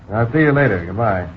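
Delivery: ordinary delivery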